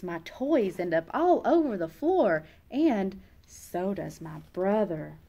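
plain reading voice

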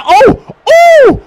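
loud, high shouted oh of excitement; excited oh. Real, not acted. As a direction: scream it as the play lands. Big play reaction.

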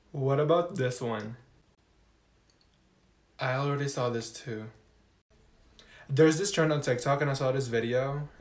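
soft, low undertone; quiet voice. Acted. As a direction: keep it down in class. Quiet talk in class.

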